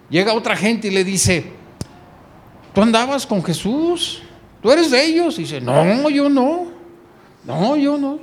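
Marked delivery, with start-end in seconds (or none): 2.7-5.4 high, pushy accusation climbing louder; accusing bystander. Acted. A bystander accuses Peter, pressing and loud. 5.6-8.2 high, frantic denial; panicked denial. Acted. Peter denies knowing Jesus, high and scared.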